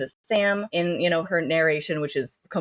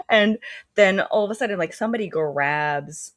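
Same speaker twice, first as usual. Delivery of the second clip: ordinary talk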